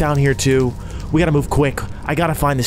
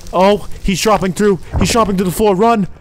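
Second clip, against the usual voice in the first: high, urgent yelling; panicked shout; 8 semitones higher, 3 dB louder than usual. Real, not acted. Player flees the angel dropping through, panicked shout. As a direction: shout to flee for your life.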